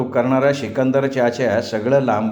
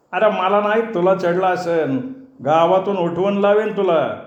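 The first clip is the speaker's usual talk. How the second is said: angry retort; 6 semitones higher than usual. A narrator's reading. Drunken boatman threatens back in quarrel.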